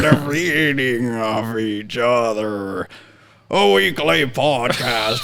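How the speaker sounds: a light Sling Blade drawl. Acted. Friend says the host sounds like Sling Blade, slow drawl. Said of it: "You sound like Sling Blade".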